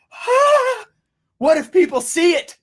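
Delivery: gasp, then a high, loud worried cry; mock panic. Entrepreneur about to launch, mock-panicked. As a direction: play up launch jitters, high-pitched and loud.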